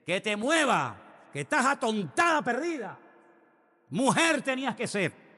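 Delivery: high, yelling driver's rant; road rage. Retold or imitated. Pastor yells at a stopped driver at a green light, high and angry. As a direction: shout at the stalled car ahead. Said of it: "empecé".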